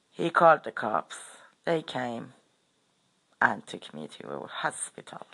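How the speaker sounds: ordinary delivery, nothing marked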